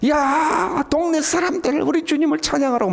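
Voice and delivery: high-pitched call to the neighbours; joyful cry. Acted. Believer calling the villagers to praise, joyfully. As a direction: cry out as if rushing outside with news. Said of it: "기쁨으로 뛰쳐나오지 않겠어요".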